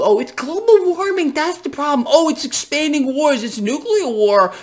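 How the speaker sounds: high, sing-song mock of the news talking point; a sneering imitation of pundits; mocking mainstream voice. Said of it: "they're being told".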